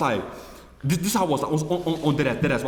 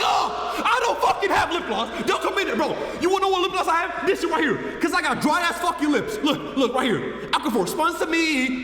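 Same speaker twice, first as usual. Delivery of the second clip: laughing indignant yelling; 13 semitones higher than usual. Real, not acted. Answering chat's lip gloss jokes, yelled laughing protest.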